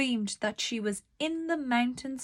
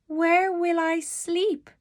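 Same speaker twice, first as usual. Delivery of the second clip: put-on little girl asking; 8 semitones higher, 4 dB louder than usual. A narrator's reading. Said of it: "she asked".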